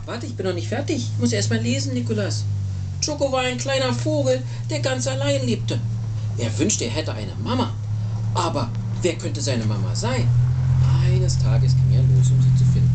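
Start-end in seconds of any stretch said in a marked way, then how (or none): 3.0-12.9 lively bedtime-story narrator; story narration to a child. Acted. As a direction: tell it with big storybook intonation. Reads picture book to child.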